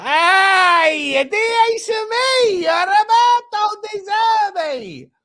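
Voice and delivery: put-on high, loud, slow Italian accent call; Italian impression. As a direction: call out the name like a hammed-up Italian. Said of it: "it was an Italian impression of".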